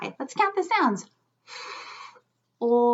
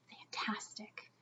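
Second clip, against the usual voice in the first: whispered word; 3 semitones lower, 22 dB quieter than usual. Shown as a demonstration. Teacher whispering the word for the class. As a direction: whisper the word fantastic softly. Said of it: "Let's all say the word fantastic".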